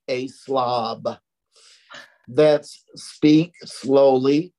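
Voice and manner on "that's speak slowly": drawn out very slowly, each word stretched; slow speech drill. Shown as a demonstration. Stroke survivor showing her speech therapy drill, said slowly. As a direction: show how the therapist taught slow speech. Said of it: "teach me how to speak like".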